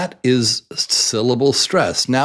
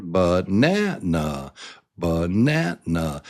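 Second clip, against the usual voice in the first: banana slowly, NAN stressed; banana in clear syllables, strong middle; 2 semitones lower than usual. Shown as a demonstration. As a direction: stretch and lift NAN in banana. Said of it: "Do you hear it?".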